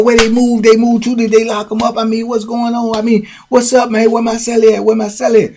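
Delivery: high, urgent questioning; panicked. Retold or imitated. Charles at the booth hunting his cellmate, high panicked. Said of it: "you can see the panic in his face".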